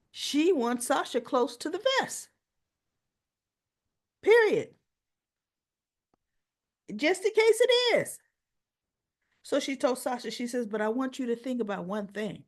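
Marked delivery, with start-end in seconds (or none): 4.2-8.2 emphatic. Real, not acted. Narrator's own emphatic comment.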